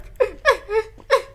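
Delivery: high loud laugh through sobs; hammed-up sob-laugh; laugh-crying. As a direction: break into teary laughter.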